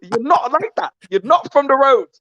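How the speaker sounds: imitating Tunde. Tunde telling Pat he's not from the road, accented. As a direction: lay on Tunde's street accent. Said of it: "He said".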